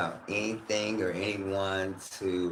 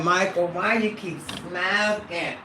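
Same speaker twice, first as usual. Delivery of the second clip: slow, higher teasing voice of a nagging fan; lightly voiced nagging fan; mock viewer voice; 6 semitones higher, slower than usual. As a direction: play the viewer scolding him. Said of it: "I know y'all like".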